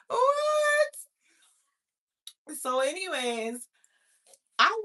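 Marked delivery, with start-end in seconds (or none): 0.1-0.9 high, loud outburst of disbelief; incredulous, piercing yelp; shocked. Retold or imitated. Shocked reaction in the retelling.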